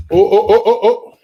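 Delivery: quick, loud, high string of exclamations; sudden high-pitched alarm at an unruly dog; startled at the dog. Real, not acted. Reacting to the agitated dog.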